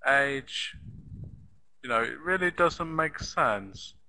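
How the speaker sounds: normal talking voice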